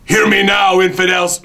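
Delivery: booming villain proclaiming to infidels; megalomaniac rant. Acted. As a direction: preach your threats like a fanatic leader. Character promo rant.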